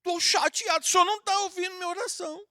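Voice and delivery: high, hurt complaint to God; upset prayer. Shown as a demonstration. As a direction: act out a child telling God they're upset. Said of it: "fala assim".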